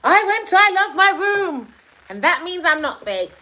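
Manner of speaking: high, loud mocking boast; mock someone showily proving they're genuine; hammed-up, high-pitched bragging voice; boastful fake person. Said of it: "people will try and prove that they're not fake by coming to say".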